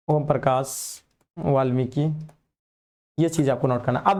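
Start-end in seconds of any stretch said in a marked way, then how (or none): 0.1-2.1 slow, spaced-out repeat of the name; half-speed, for writing down; slow dictation. Shown as a demonstration. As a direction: dictate it slowly, pausing between words. Repeats answer for note-taking.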